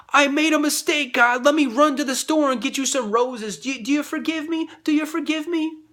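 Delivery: high, loud, whiny begging to God; mock pleading sinner. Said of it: "Religion is saying".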